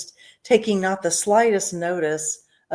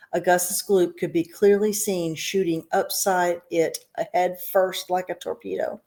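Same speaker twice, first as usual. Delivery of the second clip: plain reading voice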